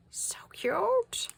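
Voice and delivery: very high, airy little coo; cutesy gushing. Real, not acted. Gushing over little dragonflies.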